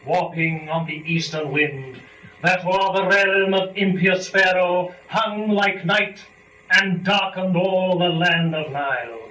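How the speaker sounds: slow, grand recitation of epic verse; epic poem reading. Acted. Reader performs Book One of Paradise Lost for students, slow and grand. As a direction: declaim the lines with weight and ceremony.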